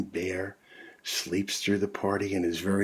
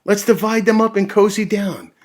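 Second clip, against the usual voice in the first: badger voice; 11 semitones higher, 7 dB louder, faster than usual. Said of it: "Badger says with a grin".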